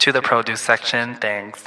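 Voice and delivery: low, monotone store intercom voice; Walmart announcer. Pretend. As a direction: page staff in a deadpan drone. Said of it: "be, like, the Walmart guy".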